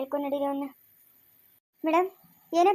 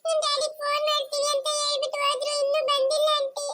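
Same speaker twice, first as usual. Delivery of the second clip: put-on high child's voice, innocent and pleading; a lost small girl explaining to the lady officer; little girl telling her story; 12 semitones higher than usual. (ಮುಗ್ಧ ಪುಟ್ಟ ಹುಡುಗಿಯ ಬೇಡಿಕೆ)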